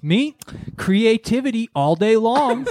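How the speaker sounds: lightly voiced smug shop lady, stretched out; mocking the shop lady. Host mocking the furniture ad lady, hammed-up smug voice. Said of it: "And she goes".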